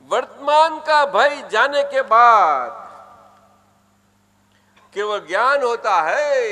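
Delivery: loud, measured recitation for a congregation; declaiming a sutra. Real, not acted. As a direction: call it out for them to repeat. Recites sutra for audience to repeat.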